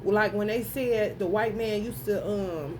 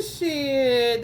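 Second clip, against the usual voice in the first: ordinary delivery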